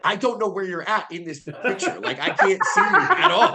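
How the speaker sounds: laughing through the quote; words shaking with laughter; laughing while talking. Real, not acted. Retelling reply to client, laughing.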